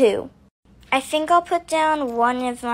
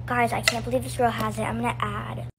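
ordinary talk, nothing marked

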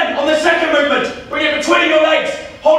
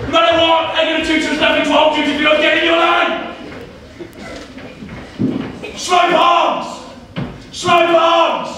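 barking drill sergeant. Acted. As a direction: bark every order like an old army sergeant. Drill sergeant bawling at recruits.

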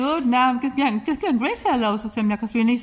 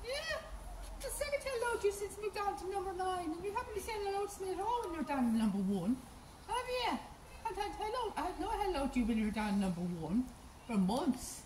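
high, soft pet-talk voice; soft high sing-song pet talk; cooing to a horse; 6 semitones higher, 11 dB quieter than usual. Real, not acted.

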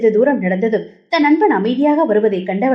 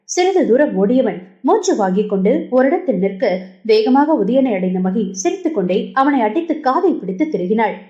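ordinary delivery, nothing marked

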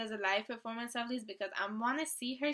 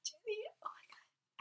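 high whisper; 11 semitones higher, 9 dB quieter than usual. Real, not acted. Whispered squeal.

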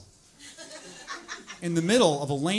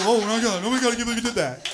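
higher, louder, fast hammed-up panic; mock panicked cyclist; 6 semitones higher, 6 dB louder, faster than usual. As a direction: squeal like a spooked hipster. Mocking the scared cyclist.

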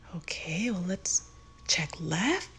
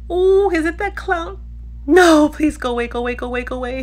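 high loud desperate begging; terrified pleading; 4 semitones higher, 10 dB louder, faster than usual. Acted. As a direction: beg it to go away. Clown returns, pleading.

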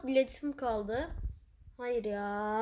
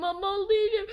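higher, louder odd voice on "Ben mal değilim"; silly high repeat; 8 semitones higher, 6 dB louder than usual. Acted. Gamer denying he is an idiot in a goofy high voice. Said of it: "Şaka yaptım".

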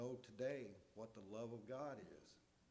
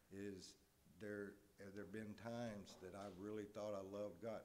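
ordinary talk

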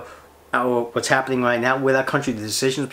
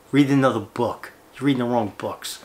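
ordinary talk, nothing marked

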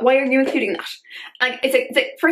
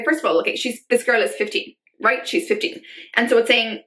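usual speaking voice

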